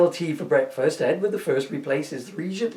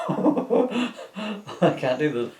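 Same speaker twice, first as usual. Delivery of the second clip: slow, laughing through the words; 7 semitones higher, much slower than usual. Real, not acted. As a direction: giggle while refusing. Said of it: "laughing at rude joke".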